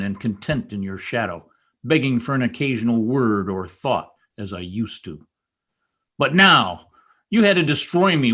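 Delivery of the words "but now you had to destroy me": higher and louder, a sudden flare of rage; angry outburst. Acted. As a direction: burst out as the bitterness boils over. Heathcliff's bitter outburst.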